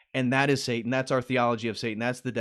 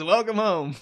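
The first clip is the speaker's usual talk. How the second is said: imitating everyone; 8 semitones higher, 3 dB louder than usual. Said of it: "It's like everybody says".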